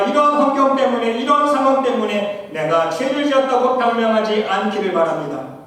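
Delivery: loud, high ringing preacher's cry; full-force preaching. Acted. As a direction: lift the congregation toward heaven. Exhorting the congregation at full force.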